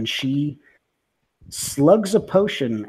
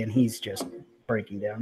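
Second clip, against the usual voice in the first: usual speaking voice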